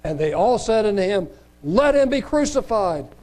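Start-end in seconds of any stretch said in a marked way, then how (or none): 1.6-3.1 raised, higher demanding voice; crowd demanding. Acted. Crowd demanding Pilate crucify him, raised voice.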